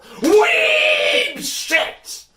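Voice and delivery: very high, loud shriek; shout. Real, not acted. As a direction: scream it out in disgust. Outburst at the weeb game.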